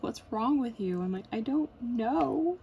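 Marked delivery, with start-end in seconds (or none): 0.0-1.0 higher, louder mock questioning; imitated baffled husband. Husband asks his crying wife, baffled. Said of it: "And he's like". 1.3-2.6 high, loud mock wail, slowly drawn out; imitated sobbing. Crying wife answers husband, mock sobbing. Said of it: "And I'm just sobbing".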